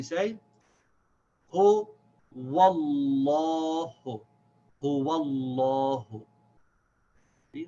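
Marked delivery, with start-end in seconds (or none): 1.5-4.2 "Hu wallahu" slow and loud, hu separate, wal held into long aw; stretched "Hu wallahu", rounded middle vowel; connected waw. Shown as a demonstration. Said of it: "What gets connected is this waw". 4.8-6.2 "Hu wallahu" slow, run together with long aw; drawn-out joined "Hu wallahu"; connected reading. Shown as a demonstration. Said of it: "We skip this".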